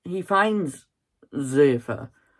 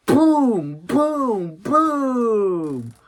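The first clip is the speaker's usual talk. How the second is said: higher, louder booms; acting out the fight's punches; punch sound effects; 7 semitones higher, 4 dB louder, much slower than usual. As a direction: punch out each boom.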